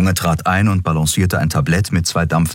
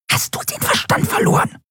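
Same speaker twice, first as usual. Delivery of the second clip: whispered, hissing outrage; angry hiss. A narrator's reading. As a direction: hiss it at your friend in disbelief. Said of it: "zischte Nelen". (wütend zischen)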